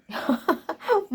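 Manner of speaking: light amused chuckle. Real, not acted. Laughs at child's answer.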